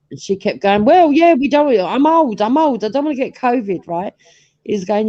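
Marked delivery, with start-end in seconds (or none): 0.8-3.9 put-on anxious old mum. Mum voiced, pleading.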